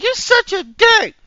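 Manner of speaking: high, loud, drawn-out woman's retort; slow, high-pitched mock outrage; mock indignant woman. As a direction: imitate her in a loud falsetto. Acts out woman's retort in story.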